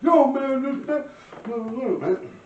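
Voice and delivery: high-pitched imitated pleading; flustered protest. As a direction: burst in loud, then stammer it out high. Imitating the man backing off, flustered.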